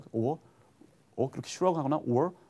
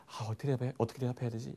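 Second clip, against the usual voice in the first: at a loss; 7 dB quieter, faster than usual. Acted. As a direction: act mildly stumped, half to yourself. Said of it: "어떤 대답을 몰라서". (당황해서 작게 혼잣말하듯)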